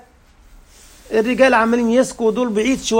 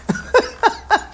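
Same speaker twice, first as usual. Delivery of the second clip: short high giggle; high burst of laughter; 12 semitones higher than usual. Real, not acted. Preacher laughing at his own joke to the congregation, high laugh.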